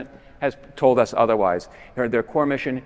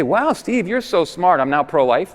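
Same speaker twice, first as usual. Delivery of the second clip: much higher, louder, gushing mock praise; mock convert; 6 semitones higher, 6 dB louder, slower than usual. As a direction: overdo the wide-eyed admiration. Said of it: "if they say".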